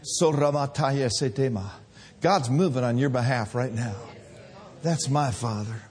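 hushed reverent sermon close; tender preaching. Acted. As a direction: gently draw them into awe. Soft closing reflection.

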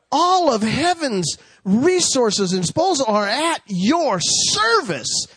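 high, drawn-out revival preaching; impassioned pulpit proclamation; fervent preaching. Acted. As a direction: preach it in big rising phrases, stretching the key words. Preaching at full force.

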